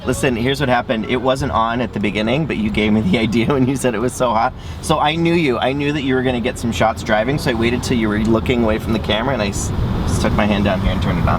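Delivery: loud, proud explanation; loud gloating. Real, not acted. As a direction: boast it loudly.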